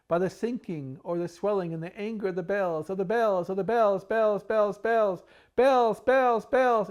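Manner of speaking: wild alarm of clanging bells; building bells. Acted. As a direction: crescendo the bells to a cry. Climax of repeated bells.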